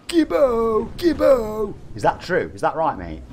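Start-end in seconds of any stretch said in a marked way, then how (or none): 0.1-1.7 loud, high-pitched nickname holler; imitated shout. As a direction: holler it like a mate across the street. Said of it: "has shouted the following".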